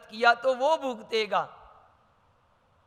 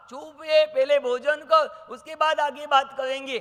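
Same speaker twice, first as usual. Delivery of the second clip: higher, louder scolding hush; stern father; 5 semitones higher, 5 dB louder than usual. Acted. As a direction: shut the boy up mid-meal. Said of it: "बाप के". (पिता की ऊँची, डाँटती आवाज़)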